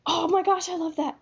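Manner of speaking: high, rising squeals of delight; gushing excitement. Retold or imitated. Reader gushing over the book, pitched high and excited. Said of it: "the whole time I was like".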